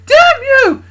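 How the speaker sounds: loud, high frightened scream; scared. Acted. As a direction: shriek it out of fear. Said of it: "About being scared".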